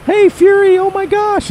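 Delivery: high, loud gushing hey Fury; starstruck fan. Retold or imitated. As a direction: squeal it like you spotted a celebrity. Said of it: "some guy came up to me and he's like".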